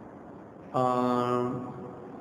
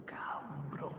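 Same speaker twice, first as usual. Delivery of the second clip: whispered swear; 16 dB quieter than usual. Real, not acted. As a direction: drop to a breathy whisper on cabrón. Como dicen aquí. (susurrado, como confidencia)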